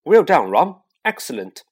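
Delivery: loud, self-important praise; Percy congratulating Ron pompously. Acted. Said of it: "said Percy Weasley pompously".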